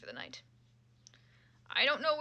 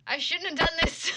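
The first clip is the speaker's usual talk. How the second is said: loud, higher cry of regret; dismayed outcry; 5 semitones higher, 8 dB louder than usual. Real, not acted. Streamer crying out after reading fan fiction. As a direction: wail that you regret it.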